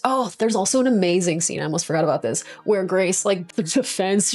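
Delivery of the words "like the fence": ordinary talk, nothing marked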